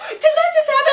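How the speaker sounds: mock horrified reaction; shocked disbelief. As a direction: gasp it out in laughing disbelief. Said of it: "we were like".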